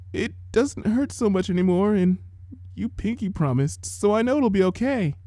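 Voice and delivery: a weak little filly shielding her eyes; small sick-child voice. A narrator's reading.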